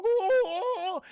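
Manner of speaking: loud, high wordless wailing; muffled mock moan. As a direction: wail as if gagged. Imitates gagged man's moans.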